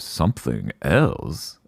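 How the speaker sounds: a hammed-up baddie savoring the news. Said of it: "And he goes".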